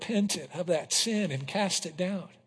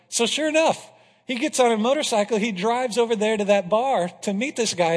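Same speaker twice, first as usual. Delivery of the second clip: ordinary talk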